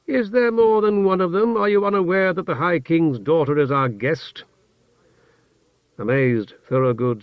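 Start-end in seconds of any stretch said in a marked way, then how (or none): 0.1-4.4 sharp sarcastic rebuke, higher; cutting, scornful question; cold fury. Acted. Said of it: "returned his stare icily".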